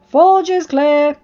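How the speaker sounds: calling out. A narrator's reading. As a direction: holler it across the forge. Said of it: "Gobber called".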